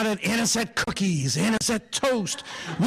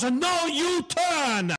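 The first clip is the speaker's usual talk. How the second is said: Italian uncle; 7 semitones higher, much slower than usual. Retold or imitated. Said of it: "He goes".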